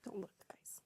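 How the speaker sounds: breathy whispered ASMR; whispering. Real, not acted. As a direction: whisper it close to the mic. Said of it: "Some A S M R".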